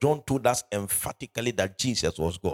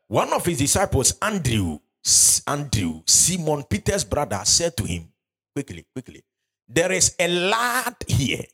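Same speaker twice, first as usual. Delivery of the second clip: scripture reading; 4 semitones higher than usual. Acted. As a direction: raise the voice and drag the verse out. Reads verse eight.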